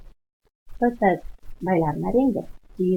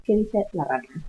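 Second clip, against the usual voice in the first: usual speaking voice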